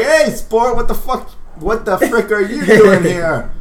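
high, loud, slow big-brother scold; high mock-scolding Skid. Skid scolding his little sister, high and loud. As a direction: scold her in a squeaky voice.

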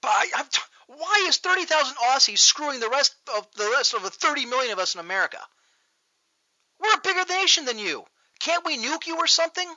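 high-pitched, indignant ranting; exasperated rant. Real, not acted. Exasperated rant at Australian players.

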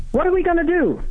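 high, eager mock question; a quick, lightly hammed-up American "what now"; impatient Americans asking. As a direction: imitate the rush to action, pitch up. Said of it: "they ask the question".

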